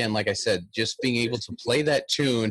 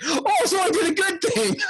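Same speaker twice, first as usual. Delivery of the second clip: much higher, laughing out the words; laughing realization; 3 dB louder than usual. Retold or imitated. Piper realizing he pleased the judge, laughing much higher. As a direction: blurt it through a laugh of relief. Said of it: "And I was like".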